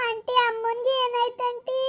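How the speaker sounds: high, wailing, sing-song crying question; tearful plea. Acted. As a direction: beg the aunt through tears. Daughter crying over collapsed mother.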